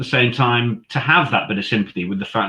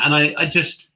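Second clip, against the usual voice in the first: ordinary talk, nothing marked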